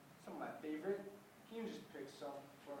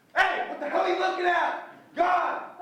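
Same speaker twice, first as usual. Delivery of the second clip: furious yell at a stranger; angry shout; 21 dB louder, slower than usual. Acted. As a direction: scream it out across the room. Yells at stranger in theater.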